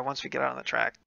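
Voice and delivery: ordinary talk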